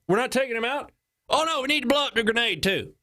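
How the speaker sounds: high-pitched mock Texas voice; Texas accent. Texas caller and base debate the sniper, mock Texas accent. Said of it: "This is Texas accent, by the way".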